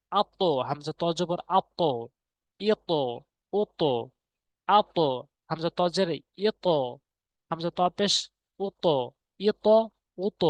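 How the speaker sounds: আত ইত উত with the ত bouncing upward; আত ইত উত each with an upward jolt; qalqala rising. Shown as a demonstration. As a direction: kick the ত of আত up, echo rising. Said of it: "এভাবে উপরের দিকে পড়বে".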